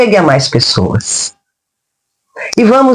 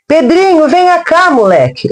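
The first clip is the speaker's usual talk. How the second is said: calling out; 7 semitones higher than usual. Acted. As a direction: call the boy from a distance. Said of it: "eu gritei".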